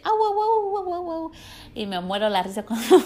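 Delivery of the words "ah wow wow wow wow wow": high, loud whimpering howl; yelping dog howl; imitating a crying dog. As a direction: mimic the dog's wailing cry, pitched way up. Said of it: "empieza a llorar y dice".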